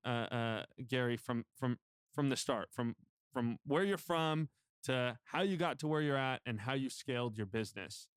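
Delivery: normal talking voice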